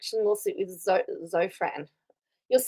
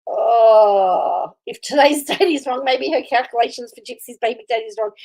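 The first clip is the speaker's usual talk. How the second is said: normal talking voice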